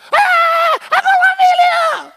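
very high, loud delighted shriek; winner's scream. Retold or imitated. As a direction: scream like a quiz show winner. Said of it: "And they start screaming".

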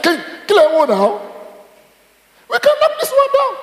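high, laughing, boastful voice; cocky young David. Pretend. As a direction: brag to the king that it's easy.

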